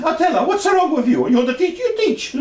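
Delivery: laughing imitation. Son recounting mother's jab at the nun, laughing. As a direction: replay mum's comeback, cracking up.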